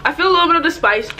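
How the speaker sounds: eating spicy snack; chewing while talking. Real, not acted. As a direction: mouth partly full. Seen 3: put snack in mouth at -3, pursed chewing mouth, spicy grimace.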